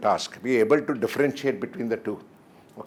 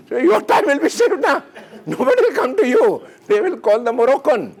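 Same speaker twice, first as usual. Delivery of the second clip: high excited joking; 12 semitones higher, 4 dB louder than usual. Acted. Joking about secretary idea.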